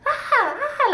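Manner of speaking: high mock scream of women in labour; high, half-laughed imitation of screaming. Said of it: "I kept hearing screams from other rooms".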